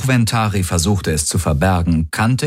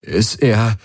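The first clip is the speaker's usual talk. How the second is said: higher, faltering half-question; voice breaking; 4 semitones higher than usual. A narrator's reading. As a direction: let the voice catch and break off. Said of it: "ließ seine Stimme brechen".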